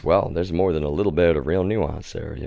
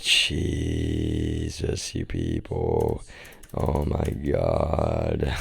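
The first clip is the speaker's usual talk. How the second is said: much lower, soft and slow, fed up; exasperated groan; 11 semitones lower, 10 dB quieter, much slower than usual. Real, not acted. Fed up with people, a low slow groan. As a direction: drag it out low in disgust.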